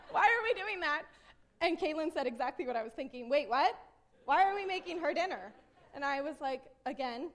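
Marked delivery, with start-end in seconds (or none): 0.0-1.0 high, loud question through laughter; laughing disbelief. Retold or imitated. Mom startled by her own idea, incredulous. Disbelief at making neighbor dinner. 3.3-5.5 loud, raised retold protest; incredulous. Daughter questioning mom's dinner plan, incredulous.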